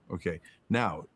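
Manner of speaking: normal talking voice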